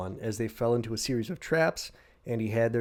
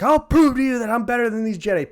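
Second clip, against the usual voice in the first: higher, louder determined declaration; vengeful vow; 11 semitones higher, 7 dB louder than usual. Retold or imitated. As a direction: swear it through seething resolve. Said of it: "he's like".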